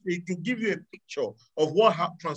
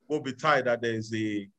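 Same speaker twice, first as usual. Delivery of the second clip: ordinary talk, nothing marked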